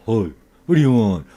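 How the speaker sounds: loud, dismissive shop clerk who brushes the customer off; a bored clerk who can't be bothered; rude salesperson. Acted. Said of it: "the person comes to me and says".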